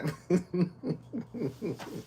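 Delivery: high giggling laugh; real giggle. Real, not acted. Streamer teasing a viewer about marriage, giggling. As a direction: giggle on, tickled by the idea.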